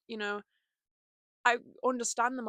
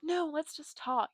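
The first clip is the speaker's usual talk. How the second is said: slow, higher-pitched acted plea; placating; 6 semitones higher, slower than usual. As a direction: talk down an imagined attacker. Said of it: "I would just be like".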